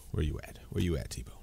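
hushed call into the dark; whispering. Real, not acted. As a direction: whisper his name out. Calling for a missing friend.

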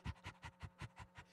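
soft voiceless panting like a happy dog; imitated happy dog pant. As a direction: pant lightly and quickly.

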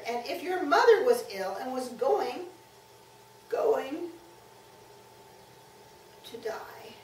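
put-on boy trying not to cry; keeping back tears. A narrator's reading. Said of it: "if you're trying to keep back tears".

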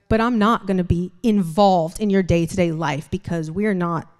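ordinary delivery

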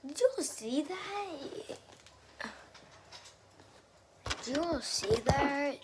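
can't believe what just happened; shocked disbelief. Real, not acted. Reacting to getting shot in game.

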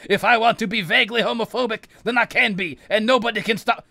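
high, loud villainous rant; defiant shouting. Pretend. Evil sorcerer defending his right to his views, defiant shouting. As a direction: shout it like an unstoppable tyrant.

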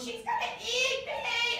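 high, slow, drawn-out wail of fright; hammed-up panic at the hungry carnivore; mock scared cry. Shown as a demonstration. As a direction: squeal it like you're about to be eaten. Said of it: "she goes".

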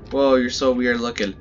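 ordinary delivery

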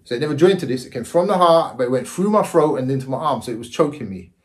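ordinary talk, nothing marked

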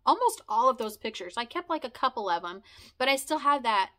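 usual speaking voice

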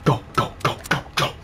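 urgent whisper. Real, not acted. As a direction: hiss it under your breath. Sneaking past enemies.

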